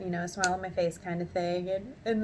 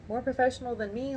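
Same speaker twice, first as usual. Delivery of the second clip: ordinary talk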